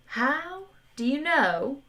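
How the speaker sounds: slow, high, chilly drawl; icy Zaphod voice. A narrator's reading. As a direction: freeze them with each word. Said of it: "in a voice that would make a vegan snow lizard feel chilly".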